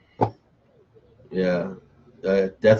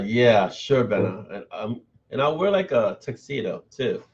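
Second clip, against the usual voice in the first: ordinary talk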